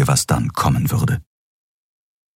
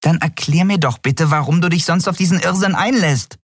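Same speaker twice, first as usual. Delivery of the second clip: high, challenging little voice; exasperated know-it-all squeak; 13 semitones higher than usual. A narrator's reading. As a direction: demand an explanation, pitched high. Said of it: "verschränkte besserwisserisch die Arme".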